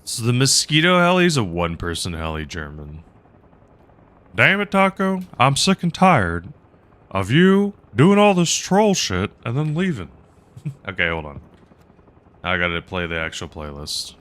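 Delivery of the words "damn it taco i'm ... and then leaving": higher-pitched stab at Hank Hill, fed up. Acted. Streamer as Hank Hill griping at troll Taco, higher pitched. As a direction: grumble at Taco like Hank losing patience. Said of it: "My impersonation is terrible".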